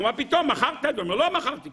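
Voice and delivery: high-pitched imitation of a quibbler; mock indignant denial. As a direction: protest in playful, high-pitched disbelief. Acts out Esau disputing the sale.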